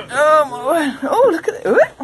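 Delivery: high, loud delight; "Oh, look at it" in a rising, thrilled voice; excited. Real, not acted. First look at cabin.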